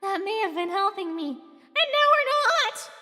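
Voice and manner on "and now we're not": high, slow sulky whine; pouting. Acted. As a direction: drag it out like a sulking kid.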